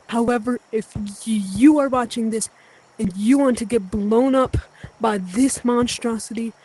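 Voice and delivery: ordinary talk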